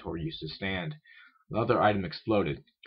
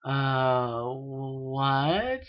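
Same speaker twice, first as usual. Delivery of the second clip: higher, drawn-out questioning groan; groggy groan; 5 semitones higher than usual. A narrator's reading. Filly waking up tangled in bedding, groaning in confusion. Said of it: "shook her head and groaned".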